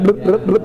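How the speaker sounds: blip-blip of wine past a cork; glugging imitation. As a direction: mimic a bottle glugging around its cork.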